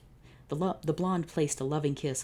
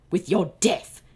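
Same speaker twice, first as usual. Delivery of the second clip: much louder, higher shout; villain battle cry; 5 semitones higher, 10 dB louder, slower than usual. Acted. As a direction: bellow it as the sword swings. Villain attacks with sword.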